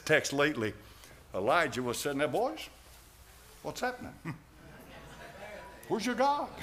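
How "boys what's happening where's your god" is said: higher, softer teasing taunt; mocking taunt. Elijah taunting the prophets of Baal, soft mocking tease. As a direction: needle them gently with a grin. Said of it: "He mocked him".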